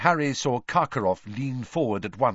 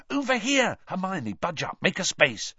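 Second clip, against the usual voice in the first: high, eager urgent call; 9 semitones higher than usual. A narrator's reading. Ron urging Hermione to make room for Krum. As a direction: hurry them to make room.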